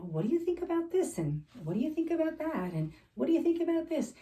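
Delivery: bright chatty quote. Retold or imitated. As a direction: breeze through it like shopping with a friend. Retelling helping woman pick clothes.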